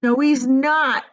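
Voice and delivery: loud, slow firm denial; Grandpa Joe firm. A narrator's reading. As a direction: stand up for him firmly. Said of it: "said Grandpa Joe".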